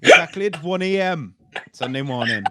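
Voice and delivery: put-on comic Welsh accent reading a witness account; funny Welsh accent. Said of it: "read his account of finding the body in a funny accent".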